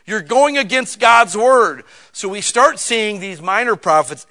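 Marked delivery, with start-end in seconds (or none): 0.0-1.8 louder, slow, scolding prophet; prophet preaching at people. Retold or imitated. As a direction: call them out like an old prophet, slowly. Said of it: "start preaching at people".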